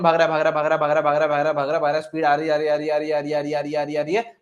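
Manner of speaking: rapid-fire chant on one note; breathless-style looping repetition; running commentary. Shown as a demonstration. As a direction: rattle it off nonstop like something picking up speed. Mimics electron speeding along, repeating words.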